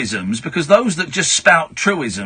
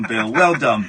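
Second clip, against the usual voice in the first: ordinary talk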